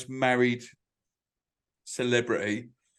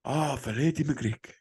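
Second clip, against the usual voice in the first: slow, drawn-out put-on Japanese accent; mock Japanese accent; 5 dB quieter, slower than usual. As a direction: stretch Freddie McGlick in a Japanese accent. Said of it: "say your name in a Japanese accent".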